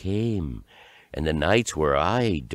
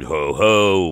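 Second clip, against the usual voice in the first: loud jeering creature call; mocking ho-ho; 9 dB louder than usual. A narrator's reading. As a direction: boom it out in mockery. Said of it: "mocked the rest of them".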